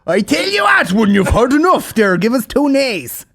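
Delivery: high, drawn-out stage Irish; exaggerated Irish caricature; mock Irish accent. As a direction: ham it up in a bad film Irish accent. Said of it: "how he does his French accent".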